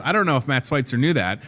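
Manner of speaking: ordinary talk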